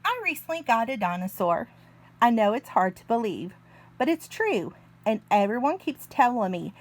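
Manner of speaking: ordinary delivery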